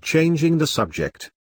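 plain reading voice

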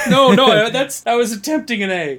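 laughing denial; laughing protest. Real, not acted. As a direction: protest through giggles. Defending a misspelling, laughing.

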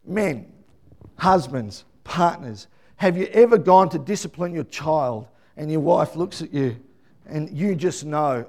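normal talking voice

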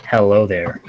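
low, brief put-on American greeting; attempted American accent. Shown as a demonstration. British podcaster pressed by friends to do it, cringey American accent. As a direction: reluctantly try out an American accent. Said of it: "I can do an American accent".

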